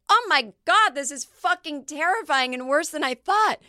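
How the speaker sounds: high, loud mock panic; mock terror. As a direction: shriek it in hammed-up dread. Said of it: "we'll be like".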